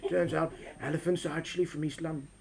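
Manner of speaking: low, hushed documentary narrator; David Attenborough. Acted. Nature presenter revealing where elephants are from, hushed narration. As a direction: drop down soft, like a nature presenter. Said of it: "with David Attenborough going".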